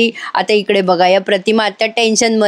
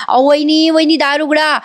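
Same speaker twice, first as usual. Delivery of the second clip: retold shouting; 6 semitones higher than usual. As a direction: play the sister-in-law banging on the door, mildly. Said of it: "मोठ्या मोठ्याने ओरडत असते".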